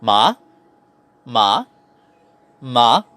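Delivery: MA starting low and rising sharply; second tone. Shown as a demonstration. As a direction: say "ma" like a surprised question. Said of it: "The pitch starts low and rises up".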